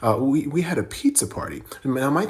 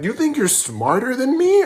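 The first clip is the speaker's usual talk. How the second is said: angry teacher; 10 semitones higher, 4 dB louder than usual. Retold or imitated. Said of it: "he's like".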